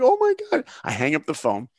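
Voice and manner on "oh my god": squealed very high and loud; can't believe it, pure elation; overwhelmed joy. Retold or imitated. Reaction to being accepted.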